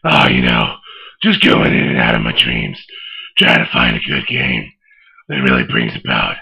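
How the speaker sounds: deep gravelly Batman growl; low, growling caped crusader. Acted. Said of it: "I thought you were gonna say Batman".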